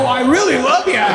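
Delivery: hammed-up adoring plea to the reluctant actor; mock gushing. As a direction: lay the flattery on thick. Pleading with actor, retold.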